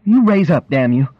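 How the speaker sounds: irritated wife's sharp demand; exasperated order. A narrator's reading. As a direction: order him up, out of patience. Wife orders husband while undressing him.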